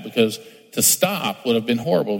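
normal talking voice

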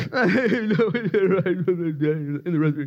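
high, put-on snippy voice; laughing, hammed-up imitation; mock snippy. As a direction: mimic her with exaggerated attitude. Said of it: "She's kinda sn".

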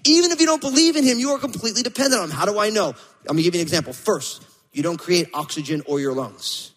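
ordinary delivery

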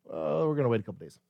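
low, quick, offhand shrug of a reply; mock nonchalance. Preacher voices an unhurried reply to urgent news, mock nonchalant. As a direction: toss it off casually, pitch dropped.

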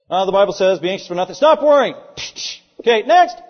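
mock-brusque counselor, loud and high on the command; simplistic counselor. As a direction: dismiss a client with a blunt loud order. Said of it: "we're a little too simplistic".

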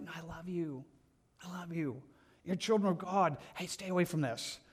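soft, gentle reassurance; tender, loving. Retold or imitated. God speaking to his children, tender and soft. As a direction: warn them lovingly, voice dropped. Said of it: "instead it's like".

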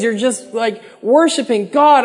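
usual speaking voice

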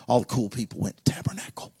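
fast confiding whisper. Real, not acted. Preacher whispering a confidence to the congregation.

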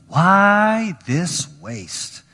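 drawn-out complaint. Acted. Reading disciples' complaint.

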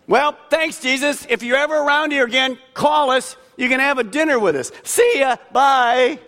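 high, breezy, dismissive farewell; mock casual goodbye. Preacher mimicking the healed men brushing Jesus off, breezy and mocking. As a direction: wave Jesus off like a neighbour. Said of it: "Notice these two guys don't go".

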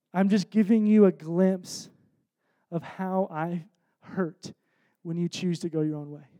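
tender grieving voice. Retold or imitated. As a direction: speak it gently, as Jesus grieving. Voicing Jesus speaking to heart.

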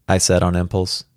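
usual speaking voice

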